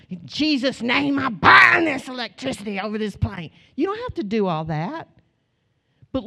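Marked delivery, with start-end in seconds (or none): 0.1-3.5 loud, hammed-up binding prayer; mock prayer warrior. Mocking an overdramatic prayer on the plane, much louder. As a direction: overdo the spiritual warfare. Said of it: "some things don't have to be held".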